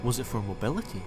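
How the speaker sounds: ordinary delivery, nothing marked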